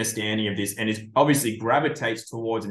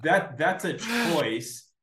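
mocking scolding impression; 3 semitones higher than usual. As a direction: laugh through a shrill-pitched telling-off. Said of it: "impression of it".

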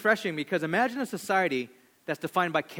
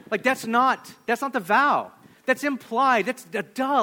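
raised high and louder, insisting it's obvious; emphatic preaching; 6 semitones higher, 5 dB louder than usual. Real, not acted. Pastor to congregation, higher and louder with exasperation. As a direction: preach it like it's plain as day. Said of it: "raised, emphatic".

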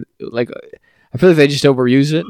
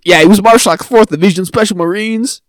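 mock boastful; 7 semitones higher, 4 dB louder than usual. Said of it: "You wouldn't be like".

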